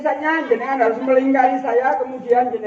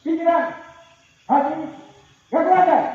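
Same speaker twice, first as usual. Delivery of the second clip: loud high command calls; game leader calls out the commands; called commands; 6 semitones higher, 4 dB louder than usual. Real, not acted. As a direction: bark each command to the group.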